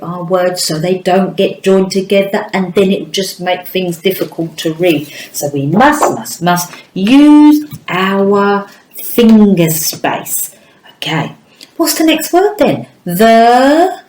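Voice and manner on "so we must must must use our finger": must must must drawn out slowly; emphatic must. Shown as a demonstration. Teacher drilling a rule into young pupils, slow and emphatic. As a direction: lean hard on each must, slowly.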